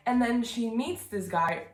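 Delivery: normal talking voice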